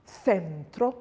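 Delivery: centro with the C softened to a TH sound; soft Spanish C. Shown as a demonstration. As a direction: say centro as "thentro". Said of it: "an E, it's soft".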